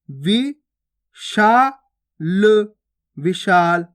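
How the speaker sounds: वि, शा, ल said apart with pauses, then विशाल blended; syllable by syllable. Shown as a demonstration. Teacher showing pupils how to blend विशाल. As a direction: sound out वि शा ल slowly, then say विशाल. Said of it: "अब देखो उच्चारण किस प्रकार से करना है".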